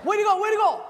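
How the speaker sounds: high, loud bewildered cry; hammed-up frantic searching shout; frantic confusion. Firing squad searching for escaped prisoner, frantic shout.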